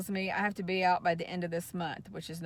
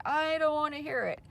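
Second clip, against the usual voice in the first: retold scream; 7 semitones higher than usual. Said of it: "And she's still screaming at me".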